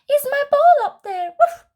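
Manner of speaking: high, loud hopeful puppy; Puppy calls up to Squirrel, high and hopeful. Acted. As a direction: call up the tree like an eager puppy. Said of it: "he asks Squirrel".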